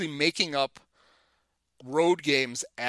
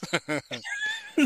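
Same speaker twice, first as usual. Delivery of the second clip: sudden high laugh; burst of laughter. Real, not acted. Laughing at own story.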